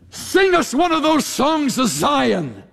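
high-pitched mocking demand; sarcastic. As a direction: taunt them like a gloating captor. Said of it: "Can you just hear the sarcasm?".

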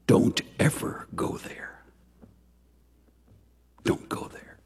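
hushed unvoiced whisper, slow on the first; a pastor whispers a warning to the congregation. Pastor warns against shaming.

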